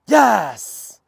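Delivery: high loud burst of praise; excited praise. Real, not acted. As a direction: reward the dog with a loud happy yes. Praising dog loudly.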